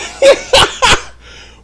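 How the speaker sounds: high-pitched cackling laugh; burst of laughter. Real, not acted. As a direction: crack up in a high giggle. Cracks up at the other host.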